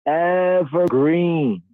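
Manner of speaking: "evergreen" stretched out slow and high; emphasis on EVERGREEN. Real, not acted. Teacher describing the myrtle tree, heavy stress on "evergreen". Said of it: "Emphasis on evergreen".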